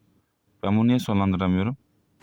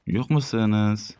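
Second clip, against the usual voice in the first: ordinary talk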